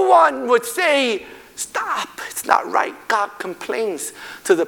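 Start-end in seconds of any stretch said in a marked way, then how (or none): 1.6-2.9 a hushed whisper of protest. Acted. Preacher to congregation, whispering the protest nobody made. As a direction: breathe the words out, no voice. Voicing the people's silent protest.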